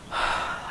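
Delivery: long soft breathy sigh out; audible sigh. Shown as a demonstration. To a fellow musician, showing a sighing gesture: an audible sigh. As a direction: let a slow sigh go, like a sighing phrase. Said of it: "literally somebody going".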